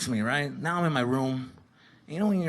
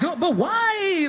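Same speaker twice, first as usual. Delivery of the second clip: put-on high, drawn-out kid's protest; mock worried whine; 13 semitones higher, much slower than usual. Kid summoned by an angry parent, high mock whine. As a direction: stretch it out in hammed-up dread.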